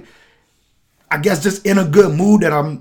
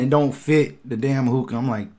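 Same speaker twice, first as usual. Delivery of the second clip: ordinary talk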